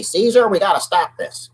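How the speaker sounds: lightly put-on, comic plea; jokey Jesus voice. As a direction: playfully voice Jesus petitioning the emperor. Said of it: "Jesus probably didn't even talk like that".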